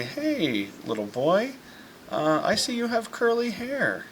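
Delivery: a mother pretending not to know her son; playful stranger. Mom playfully greets her boy like a stranger, sing-song.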